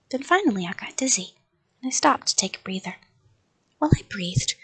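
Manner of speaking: ordinary delivery, nothing marked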